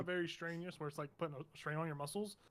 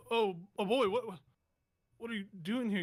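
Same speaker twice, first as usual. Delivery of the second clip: dazed wounded man; 5 semitones higher, 4 dB louder than usual. Acted. Wounded man greets his dog.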